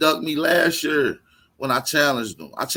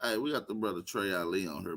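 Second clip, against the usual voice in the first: usual speaking voice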